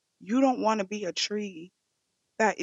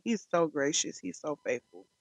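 ordinary talk, nothing marked

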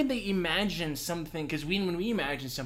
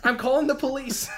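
mock alarm; 11 semitones higher, 6 dB louder than usual. Mock alarm at weed joke.

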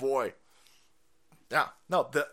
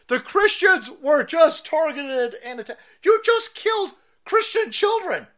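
outraged, rising to a high shout; oburzony krzyk; 10 semitones higher, 7 dB louder than usual. Real, not acted. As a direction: protest loudly, pitch climbing as you go. Angry rant at the headline.

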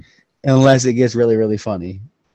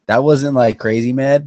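ordinary delivery

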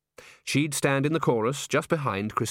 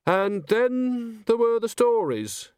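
high, slow, dazed driver voice; a rattled coach driver drawling out his memory; 11 semitones higher, 3 dB louder, much slower than usual. Acted. Driver tells the agent, high and dazed.

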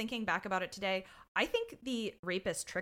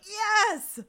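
high, loud burst of delight; excited cheer; 13 semitones higher, 8 dB louder than usual. Retold or imitated. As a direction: cry out in gleeful agreement. Retold excited reaction, laughing.

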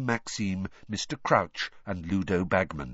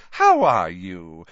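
Rita Skeeter; 2 semitones lower, 9 dB louder, slower than usual. A narrator's reading. Rita greets Dumbledore.

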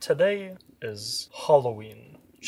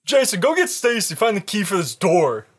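put-on much higher, louder teen argument; arguing teens; 8 semitones higher, 14 dB louder, faster than usual. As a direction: bicker like scared teens.

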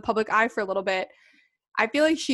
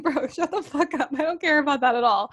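dismissive; 4 semitones higher than usual. Retold or imitated. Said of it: "and I was like".

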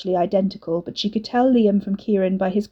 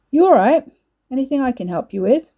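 cocky cheerful; 5 semitones higher, 5 dB louder than usual. A narrator's reading. Ricky cheerful cocky greeting.

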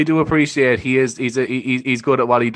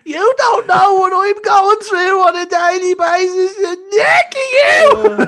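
very high, louder wailing sob-shout, slowed; hammed-up hysterical crying on the phone; mocking the crying sister; 8 dB louder, slower than usual. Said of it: "his sister calling a nail bar".

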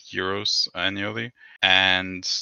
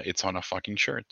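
normal talking voice

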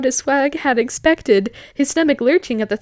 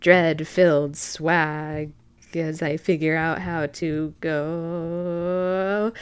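ordinary delivery

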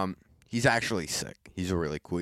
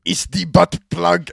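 slow, loud, high German voice; German accent; 7 semitones higher, 9 dB louder than usual. Retold or imitated. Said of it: "the most stereotyped German ever".

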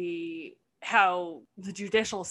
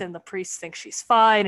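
ordinary talk

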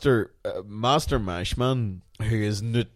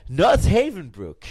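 acted quote; 7 semitones higher, 6 dB louder, slower than usual.

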